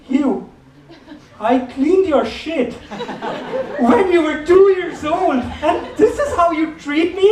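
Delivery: high, slow, offended mom voice with a laugh in it; mock outraged mother guilt-tripping her son; indignant mom. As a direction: play the outraged mother, pitch up and drawn out. Mom's indignant reply, acted.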